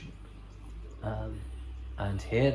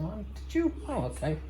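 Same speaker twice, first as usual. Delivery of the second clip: mild surprise; 10 semitones higher, 3 dB quieter than usual. Retold or imitated. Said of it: "And I said".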